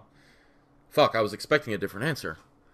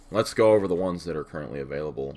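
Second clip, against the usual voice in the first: ordinary talk, nothing marked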